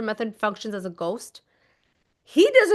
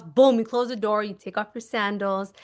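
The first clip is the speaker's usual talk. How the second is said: ordinary talk, nothing marked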